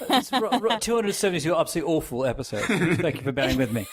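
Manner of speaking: mock British self-deprecation, quick and high at first; self-deprecating British accent. Host teasing a co-host in a British accent. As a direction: put on a sheepish British accent to apologise for the show. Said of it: "in a self-deprecating British accent".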